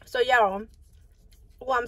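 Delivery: talking with food in mouth; speaking between bites; chewing food. Real, not acted. Seen 3: Bite taken before; lips pressed, cheek bulging while talking.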